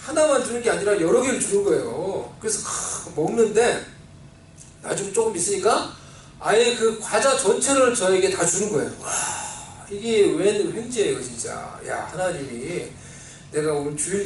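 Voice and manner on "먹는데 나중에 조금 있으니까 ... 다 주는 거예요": ordinary talk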